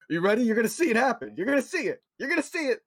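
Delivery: high, escalating hyped-up retelling; excited mock hype. Retelling the guard's eager footage reveal.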